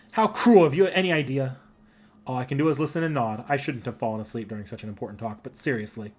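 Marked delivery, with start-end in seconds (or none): 0.1-1.6 theatrical hurt. Acted. Saber cries out at the Master, theatrical hurt. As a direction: play the wronged lover to the hilt.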